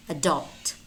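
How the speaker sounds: adult as "a-DULT"; weak first syllable in adult, stress the DULT; stress on second syllable. Shown as a demonstration. Teacher gives own preference, second-syllable stress in adult. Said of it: "I myself use".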